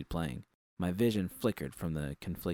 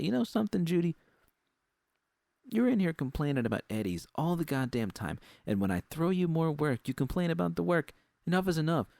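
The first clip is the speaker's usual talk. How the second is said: higher and higher furious tirade; escalating rant; 7 semitones higher, 3 dB louder than usual. Acted. Susie ranting at Judy about complaining, furious. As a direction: build the anger to a peak.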